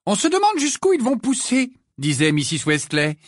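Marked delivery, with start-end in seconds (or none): 0.1-1.7 high, scolding mother voice; motherly voice. A narrator's reading. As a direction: nag gently like a mum. Mrs Weasley voix de personnage. (mère qui gronde, voix aiguë)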